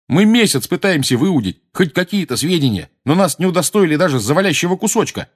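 high, loud aggrieved shout. A narrator's reading. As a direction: shout the complaint. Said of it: "крикнул Джордж". (крикнуть)

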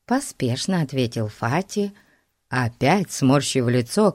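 usual speaking voice

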